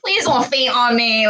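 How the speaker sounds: pleading. Retold or imitated. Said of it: "I'm like".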